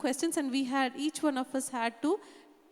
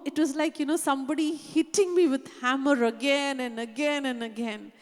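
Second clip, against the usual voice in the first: usual speaking voice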